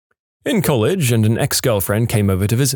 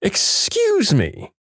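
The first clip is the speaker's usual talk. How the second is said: indignant; 12 semitones higher, much slower than usual. Retold or imitated. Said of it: "She says".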